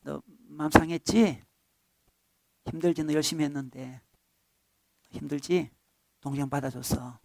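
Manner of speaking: father quietly consoling the elder son; soothing father. Acted. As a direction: coax a hurt son gently. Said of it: "하나님이 나를 달래주신다".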